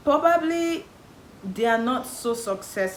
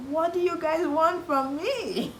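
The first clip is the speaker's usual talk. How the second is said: exasperated; 8 semitones higher, slower than usual. Retold or imitated. Exasperated at family.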